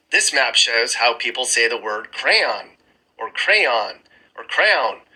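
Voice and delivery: ordinary talk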